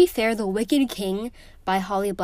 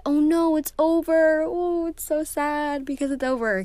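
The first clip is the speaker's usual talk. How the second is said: mock wailing; 6 semitones higher than usual. Vlogger acting out her cry at a book's ending, mock wail. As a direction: whimper it like a reader at the last page. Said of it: "kind of cry".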